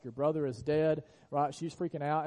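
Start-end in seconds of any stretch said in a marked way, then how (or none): 0.0-1.0 raised, louder panicked yell of a mother; mock the mother's frantic cry, a touch louder; retold yell. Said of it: "She's yelling".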